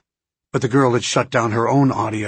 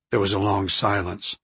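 usual speaking voice

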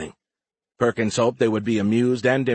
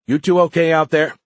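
louder, higher check-in call; 7 semitones higher, 7 dB louder than usual. A narrator's reading. Commander checking on her chief pilot, calling out. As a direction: call out to someone some way off. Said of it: "Perkins called to her chief pilot".

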